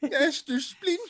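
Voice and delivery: high, slow cartoon call; put-on cartoon voice. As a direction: draw it out in a high put-on voice, half laughing. Mimics cartoon rat sensei's name.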